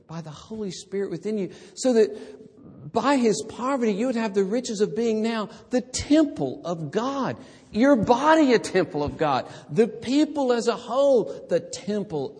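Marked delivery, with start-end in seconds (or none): 1.7-12.4 higher, rising preacher's build; fervent preaching. Acted. Preacher proclaims the riches of God to believers, fervent and higher. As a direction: lift each phrase like a crescendo.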